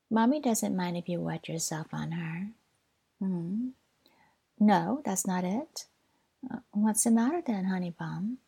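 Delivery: normal talking voice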